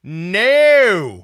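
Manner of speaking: loud, high, drawn-out mock "no"; mock horrified shout. Listener voicing the horrified parent, mock shout. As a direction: wail it in playful horror.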